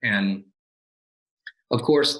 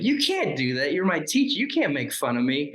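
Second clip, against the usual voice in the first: put-on higher, whiny kid protest; mock hurt protest; 5 semitones higher than usual. Student to laughing teacher, higher mock whine. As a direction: play the wounded student.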